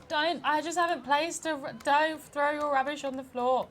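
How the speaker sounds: high, loud scolding; indignant telling-off. Real, not acted. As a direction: shout at them to stop. Telling off littering game characters.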